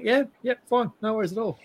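high-pitched, chirpy imitation of a mate; mock nonchalant, light and high; breezy reassurance. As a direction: wave the worry away in a lifted voice. Retold player brushing off concern.

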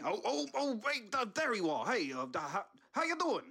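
startled, then cover with a chirpy hello; caught off guard. Acted.

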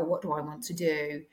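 ordinary talk, nothing marked

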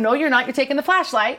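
lightly acted scolding, high and loud; mock firm refusal. Answering someone headed to the outhouse, mock firm refusal. Acts out the reply about the flashlight.

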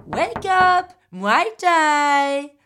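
high, ringing wake-up call; Thai driver. Retold or imitated. Thai driver knocking at seven a.m., high calling voice. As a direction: sing it out loudly as the driver. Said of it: "mon chauffeur thaï".

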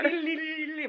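high, rapid "li-li-li" babble; imitated leggy wobble. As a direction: trill out a foal's wobbly legs. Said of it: "he's like".

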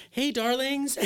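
high, loud, sing-out greeting; calling out. Retold or imitated. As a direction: imitate a passerby hailing friends from a bike. Passerby calling from a bicycle.

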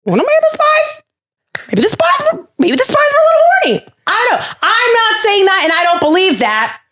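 very high-pitched comic insinuation; mock-innocent squeak. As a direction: float a cheeky theory way up high, then disown it. Jokey suggestion, then hurried disclaimer.

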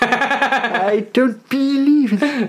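slow, drawn-out grumpy exasperation; slow disbelieving old grump; Richard Wilson impression. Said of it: "have Richard Wilson as their leader".

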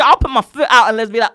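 ordinary delivery

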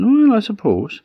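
high, drawn-up "well" of doubt; reluctant old woman. Acted. Old woman accepts goat instead of cat or dog, high and doubtful. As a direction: give in with a high-pitched, doubtful lilt.

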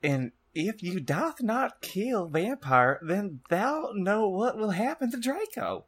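high, slow, wailing dark lord; hammed-up high-pitched villain warning; threatening villain. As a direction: draw out the threat in a high theatrical voice. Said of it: "he shouted".

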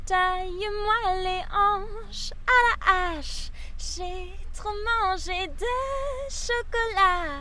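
high, slow princess singing; theme park princess. Pretend. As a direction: sing it like a sweet cartoon princess. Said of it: "une petite voix de princesse". (voix de princesse chantée, haute et lente)

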